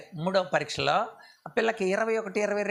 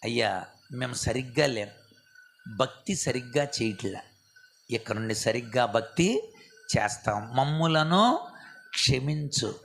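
humble confession; 6 semitones lower than usual. Shown as a demonstration. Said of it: "ఇది చెయ్యాలి మనం".

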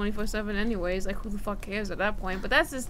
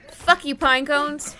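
loud slow taunt; 4 semitones higher, 8 dB louder, slower than usual. Real, not acted. Attacks pine cones.

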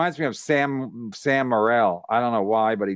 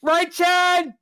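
mocking taunt; 11 semitones higher, slower than usual. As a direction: needle him in a put-on heckler voice.

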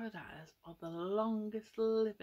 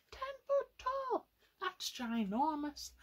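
much higher, louder, slower wonder; amazed exclaim; 8 semitones higher, 4 dB louder than usual. Acted. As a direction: gasp at how huge it is for the kids. Marvelling at tiger size.

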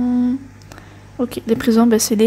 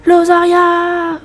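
calling out; 7 semitones higher, 6 dB louder, much slower than usual. Acted. Calling out game character's name.

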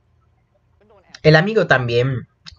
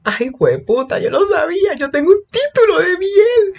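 ecstatic squeal. Real, not acted. Fan squealing that her prediction came true, high ecstatic voice. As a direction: gloat shrilly in delight.

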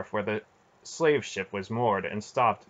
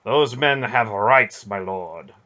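stated; 7 dB louder, slower than usual. A narrator's reading. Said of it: "Manderallen stated".

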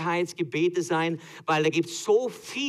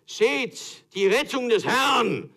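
slow, much higher and louder, laughing through it; grand proclamation; 9 semitones higher, 6 dB louder, slower than usual. Acted. Moses before the Red Sea, hammed-up grand proclamation.

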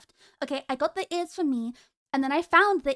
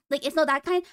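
normal talking voice

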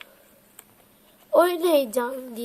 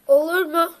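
offended disbelief; 3 semitones higher, 4 dB louder than usual. Pretend. Offended on pretend call.

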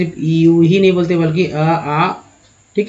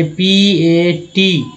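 spelled out; much slower than usual. Shown as a demonstration. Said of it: "यहाँ पर स खोजना है".

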